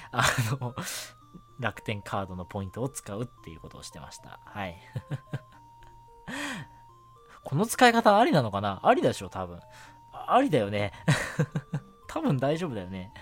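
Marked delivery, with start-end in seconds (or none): none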